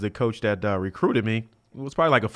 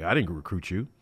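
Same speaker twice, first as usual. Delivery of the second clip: a low, softer, lightly drawled coach voice; quiet, low drawl of an intimidating coach, lightly done; coach's long drawl; 4 semitones lower, 6 dB quieter than usual. Retold or imitated. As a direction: mimic the stern coach's slow drawl, lightly. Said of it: "with the long drawl voice".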